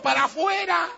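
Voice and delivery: the kingdom ordering the disciples out; commanding shout. Acted. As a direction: fling the order outward. Kingdom sending them out.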